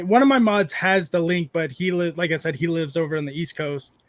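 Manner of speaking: normal talking voice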